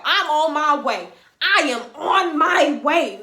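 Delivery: loud, slow, every word drawn out; fired-up mama. Retold or imitated. Mama announcing she is coming to the restaurant, fired up. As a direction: imitate a mother on the warpath, loud and deliberate. Angry mother heading over, imitated.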